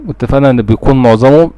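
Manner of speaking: ordinary talk, nothing marked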